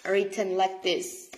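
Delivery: ordinary talk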